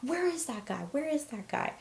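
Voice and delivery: high-pitched indignant demand, not shouted; retold outrage, pitch jumping up; mock dad rage. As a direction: imitate an outraged dad on the hunt. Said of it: "full dad rage came out".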